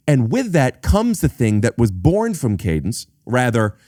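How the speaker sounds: usual speaking voice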